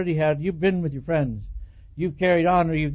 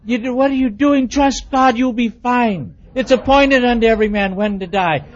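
loud, higher preachy scolding; hammed-up lecture; 3 semitones higher, 7 dB louder than usual. Husband loudly lecturing his wife to trust God. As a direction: lay down scripture at her.

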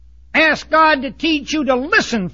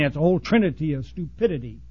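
ordinary delivery

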